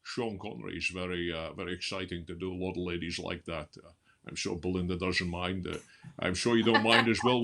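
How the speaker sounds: low, lisping Scottish film star; Sean Connery impression. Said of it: "You have to do ones like".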